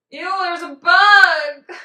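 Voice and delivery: loud startled ew at a bug; real shriek of disgust, slow; disgusted yelp. Real, not acted. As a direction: recoil loudly from a bug. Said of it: "downside about living in Georgia".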